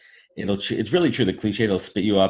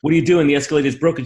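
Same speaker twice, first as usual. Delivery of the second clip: loud, raised mock scolding; imitated incredulous workman; exasperated construction worker; 6 semitones higher, 5 dB louder, faster than usual. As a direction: call her out in a higher, louder voice. Construction guy challenges lady.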